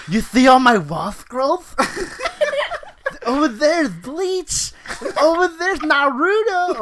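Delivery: high, slow, laughing anime nerd voice; a giggly nerd showing off his room; weeaboo voice. Acted. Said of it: "That's his weeaboo impression".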